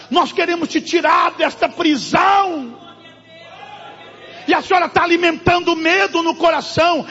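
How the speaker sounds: loud, high, shouted rebuke; full-force preacher's cry; fiery preaching. Acted. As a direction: thunder at the congregation over their fear. Preacher shouts at the fearful woman.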